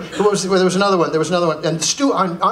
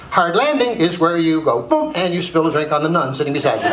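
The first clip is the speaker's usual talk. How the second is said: normal talking voice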